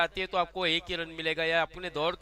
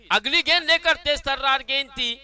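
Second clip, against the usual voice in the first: excited; 7 semitones higher, 8 dB louder than usual. Real, not acted. Fast ball commentary.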